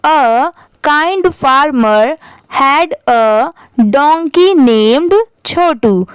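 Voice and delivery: very slow reading, pausing at the full stop; slow model reading with a clear pause at the end. Shown as a demonstration. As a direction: read it slowly, stop at the full stop. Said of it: "मैं आपको दिखाती हूँ पढ़ के कि कैसे थोड़ा रुकना चाहिए".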